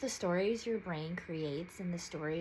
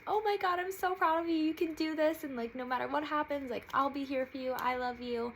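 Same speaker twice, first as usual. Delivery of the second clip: high, louder gushing support; cheerleader friend; 8 semitones higher, 4 dB louder than usual. Retold or imitated. Best friend on the phone cheering her on, high and gushing. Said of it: "my little cheerleader".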